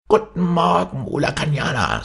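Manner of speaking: hohe, freundliche Leguanstimme; Leguan grüßt. Acted. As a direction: von oben herab hoch grüßen. Iguana greets from a tree. (hoch grüßender Leguan)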